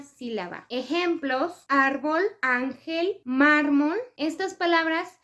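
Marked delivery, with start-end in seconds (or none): 1.7-4.0 "árbol, ángel, mármol", each stressed on its first syllable; penultimate-syllable stress. Shown as a demonstration. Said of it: "la sílaba más fuerte".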